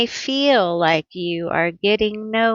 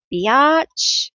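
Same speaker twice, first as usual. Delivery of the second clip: higher, louder playful sass; a teasing mock insult, higher and louder; sassy exclamation; 6 semitones higher, 4 dB louder than usual. Real, not acted. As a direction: toss it off with cheeky attitude. Sassy outburst at card pulled.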